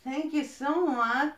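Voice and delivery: thrilled thank-you, stretching the so; excited thanks. Real, not acted. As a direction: gush your thanks. Thanking friend for plants.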